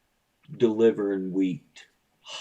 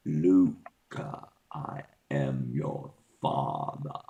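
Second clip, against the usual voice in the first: low voice through a fan; deep fan-warbled Darth voice; talking into a fan; 6 semitones lower, slower than usual. Pretend. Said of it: "That's me talking into a fan".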